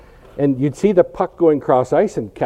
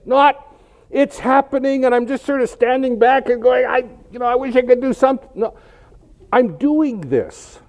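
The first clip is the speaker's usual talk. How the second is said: very high, exasperated argument; high-pitched, emphatic protest; impassioned insistence; 12 semitones higher than usual. Acted. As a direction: argue it urgently, then stretch out the final claim. Acted contrast, arguing with God.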